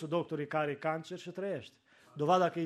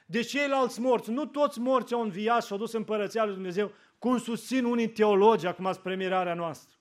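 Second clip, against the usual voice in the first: loud, high, slowed fiery preaching; preaching at full force; 5 semitones higher, 7 dB louder than usual. Real, not acted. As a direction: proclaim it to the whole church. (predică în forță, tare și înalt)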